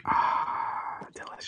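breathy ah after a sip; hushed, savoring the whiskey; whispered savoring. Real, not acted.

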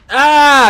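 loud, high burst of frustration; frustrated yell. Real, not acted. Gamer missing the last shot, yelling. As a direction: blurt a sharp, loud cry. Frustrated mid-fight outburst.

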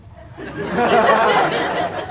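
unvoiced breathy laugh. Real, not acted. Laughing at his own Belfast joke. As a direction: huff out a laugh.

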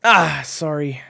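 high, loud apology; called-out apology. A narrator's reading. Coach mishits the ball, shouted apology. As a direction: shout sorry across the field.

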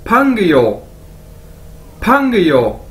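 "फंग यो" joined as one word; careful language-drill "फंग यो"; demonstrated pronunciation. Shown as a demonstration. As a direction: model "फंग यो" for learners. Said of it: "क्या बनेगा".

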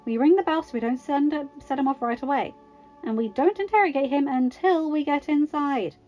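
exclaiming. A narrator's reading. Natasha reining Tony in before the bell, exclaiming. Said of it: "Natasha exclaimed".

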